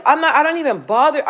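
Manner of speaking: usual speaking voice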